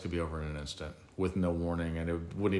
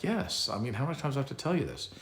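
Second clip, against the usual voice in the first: much higher, fast, fed-up retort; exasperated; 7 semitones higher than usual. Retold or imitated. Patient to doctor who keeps asking, exasperated and fast. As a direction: snap back at someone who isn't listening.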